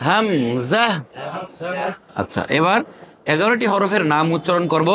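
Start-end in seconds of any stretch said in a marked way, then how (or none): 0.1-0.8 হামজা in two measured syllables, rising at the end; no elongation. Shown as a demonstration. Teacher drills হামজা for repetition, no elongation. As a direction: model হামজা with no long final vowel. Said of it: "কোনো মাদ হবে না".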